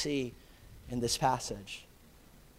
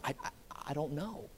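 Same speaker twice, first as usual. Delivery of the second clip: scared quiet quote; 11 dB quieter, faster than usual. Retold or imitated. Voicing a frightened believer.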